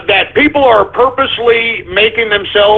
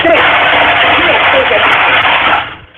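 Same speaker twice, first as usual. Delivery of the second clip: higher, strained, half-voiced demand; strained angry demand; 5 semitones higher than usual. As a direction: force it out through strain. Demanding the mic louder.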